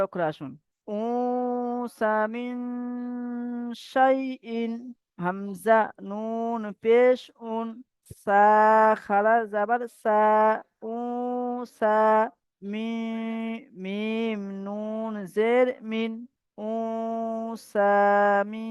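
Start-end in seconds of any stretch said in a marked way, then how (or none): 1.0-7.7 উসা মিন শাইইন stretched very slowly with a nasal hum on the n; nasal ghunna recitation. Shown as a demonstration. Teacher demonstrating ikhfa to students, slow nasal chant. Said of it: "এখানে গুন্না হচ্ছে এই কারণে". 10.9-17.9 উসা মিন শাইইন stretched very slowly with a nasal hum on the n; nasal ghunna recitation. Shown as a demonstration. Teacher demonstrating ikhfa to students, slow nasal chant. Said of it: "এখানে গুন্না হচ্ছে এই কারণে".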